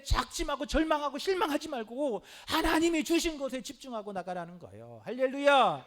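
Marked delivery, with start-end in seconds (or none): 5.0-5.9 hallelujah rung out loud to the hall; loud hallelujah call. Real, not acted. Preacher calls the congregation to answer, loud hallelujah. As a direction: call it out and let it fall.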